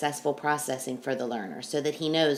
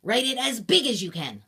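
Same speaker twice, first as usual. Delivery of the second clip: shouting directions. Real, not acted. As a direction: bark out the instructions. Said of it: "I'm gonna shout some directions at you".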